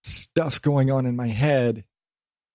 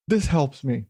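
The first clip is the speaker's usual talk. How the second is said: ordinary delivery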